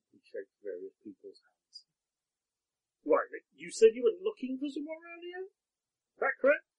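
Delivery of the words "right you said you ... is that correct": put-on higher-pitched wary old man; a farmer probing with suspicion; Podrick, suspicious farmer. GM voices Podrick.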